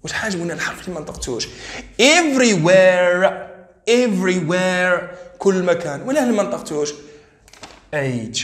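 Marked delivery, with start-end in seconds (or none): 2.0-5.2 Everywhere said very slow and loud, every syllable clear; slow model of Everywhere for repeating; model pronunciation. Shown as a demonstration. Teacher drills Everywhere for students, slow and clear. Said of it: "teacher models word pronunciation".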